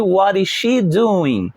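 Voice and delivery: What is she doing, very slow; slow model question. Shown as a demonstration. Teacher models the she question for the class, slowly. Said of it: "então vamos repetir".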